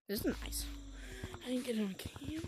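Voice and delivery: soft whisper; hushed whisper. Real, not acted. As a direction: keep it secret, barely a breath. Whispering while hiding in game.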